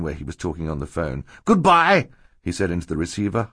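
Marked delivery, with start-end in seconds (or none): 1.4-2.1 high, loud hurried goodbye; alarmed sign-off. A narrator's reading. Banker hanging up to investigate screams, high alarm. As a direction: cut the call off in alarm.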